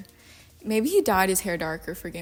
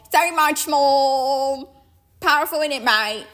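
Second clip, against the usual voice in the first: loud, higher, drawn-out British accent; 5 semitones higher, 7 dB louder than usual. Shown as a demonstration. Comic shows British sounds powerful, in booming hammed-up accent. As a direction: belt it out in hammy British. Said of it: "with a British accent".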